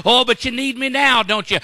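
loud laughing taunt; mocking laugh. God laughing at the needy sinner, loud mocking laugh. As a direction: laugh through the jeer. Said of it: "I'm gonna laugh".

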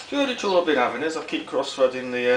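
ordinary talk, nothing marked

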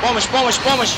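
loud urgent call for help. Real, not acted. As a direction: shout for help, rising high. Calling teammates for help.

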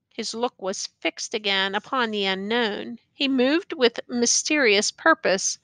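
ordinary delivery, nothing marked

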